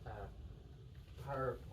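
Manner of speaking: soft, hesitant try at a Scottish word; tentative attempt. Learner copying the Scottish patient, tentative and soft. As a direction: try it under your breath, unsure. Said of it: "No, no".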